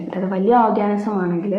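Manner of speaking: ordinary talk, nothing marked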